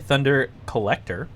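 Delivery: usual speaking voice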